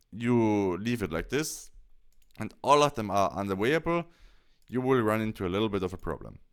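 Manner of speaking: ordinary talk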